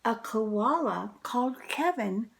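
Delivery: usual speaking voice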